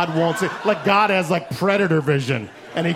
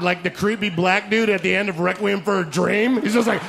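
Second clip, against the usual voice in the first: ordinary talk, nothing marked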